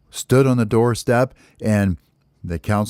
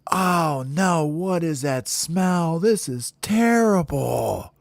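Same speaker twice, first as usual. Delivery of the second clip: high, slow, drawn-out complaint; wailed disgust; 8 semitones higher, much slower than usual. Acted. Birds woken again by the smell, wailing in disgust. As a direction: wail at an awful stink.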